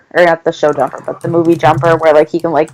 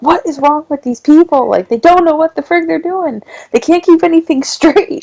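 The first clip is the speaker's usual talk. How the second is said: much higher, half-yelled rant, laughing at the end; retold exasperated rant; 10 semitones higher than usual. Hitting her husband while watching, a high retold exasperated rant. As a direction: play the fed-up viewer ranting, pitched way up. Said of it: "yelling to my husband".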